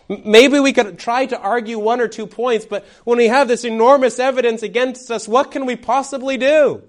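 high, helpless plea; desperate pleading. Acted. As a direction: argue desperately, pitched high, then give up. Acting out defendants with no hope.

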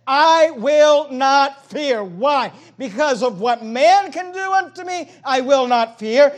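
very slow, loud, high emphasis; preaching at full force. Acted. Pastor hammering home "I will not fear", loud.

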